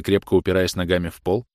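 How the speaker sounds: plain reading voice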